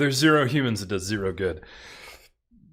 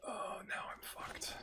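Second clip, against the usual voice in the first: whisper; 10 semitones higher, 20 dB quieter, slower than usual. Real, not acted. Sudden bad turn in game.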